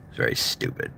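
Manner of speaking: normal talking voice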